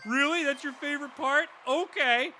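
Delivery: high, loud sarcastic put-on voice; mock disbelief. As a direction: pretend to be shocked, mockingly. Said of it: "was like".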